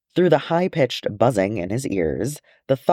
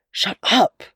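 much higher, exclaimed rebuke; sharp rebuke; 11 semitones higher than usual. Acted. A character silencing another at the council, sharp rebuke. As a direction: cut them off with a high-pitched outburst.

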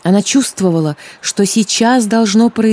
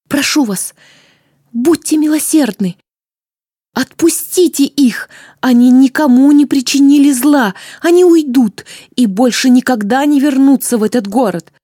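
desperate plea; 5 semitones higher than usual. A narrator's reading. Zlata begs the queen to spare her friends, high and desperate. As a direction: throw yourself on her mercy, crying out.

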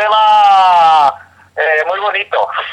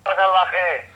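por teléfono; 5 dB quieter than usual. Real, not acted. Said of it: "Tenemos llamada en directo".

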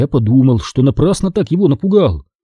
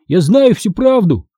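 higher, urgent exclamation; blurted out; 6 semitones higher than usual. A narrator's reading. Boy cuts off Marisa on phone, blurting. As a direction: burst in with the accusation. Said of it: "выпалил я".

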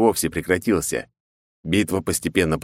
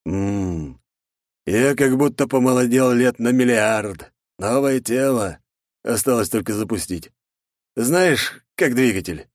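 villain voice; 5 semitones higher, slower than usual. Acted. Orion voiced in new body.

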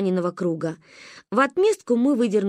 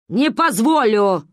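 slow, loud firm refusal; stern threat; 5 dB louder, slower than usual. A narrator's reading. Grandma faces down the woman, slow and loud. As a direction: plant each word like a threat.

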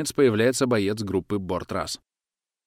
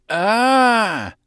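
high, loud scream of pain; 13 semitones higher, 9 dB louder than usual. A narrator's reading. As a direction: yell out from a leg wound. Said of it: "заорал террорист". (громкий крик боли)

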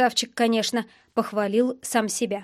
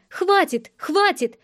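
higher-pitched urgent exclamation; crying out; 7 semitones higher, slower than usual. A narrator's reading. Sister breaking up the fight, high urgent cry. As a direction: cry out to stop them, voice lifted. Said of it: "закричала она".

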